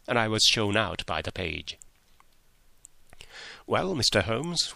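plain reading voice

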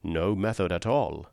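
ordinary delivery, nothing marked

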